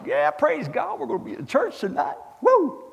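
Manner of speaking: high-pitched mock excitement, ending in a whoop; hammed-up praise with a high whoop; fired-up churchgoer. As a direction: play an over-eager church cheerleader.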